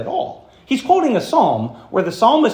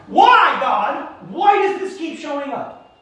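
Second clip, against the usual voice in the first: frustrated prayer; 10 semitones higher, slower than usual. Pretend.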